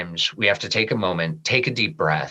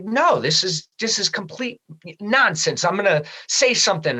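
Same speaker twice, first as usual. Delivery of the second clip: higher, indignant retort; retold outrage at a freeloader; livid protest; 8 semitones higher than usual. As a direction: push back hard on the buddy. And I am just livid.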